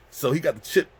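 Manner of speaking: ordinary talk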